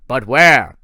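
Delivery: loud, impatient general; the general pressing Thorne, booming; booming demand. Acted. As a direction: bark the question down at him.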